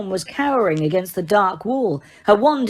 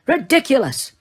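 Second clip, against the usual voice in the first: firm, clear, higher and louder command; 6 semitones higher, 4 dB louder than usual. A narrator's reading. Lupin firmly casts the spell at the boggart, clear and louder. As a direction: cast it with calm authority. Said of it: "he said very firmly and clearly".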